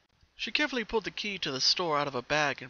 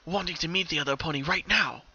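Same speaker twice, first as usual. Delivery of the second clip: ordinary delivery, nothing marked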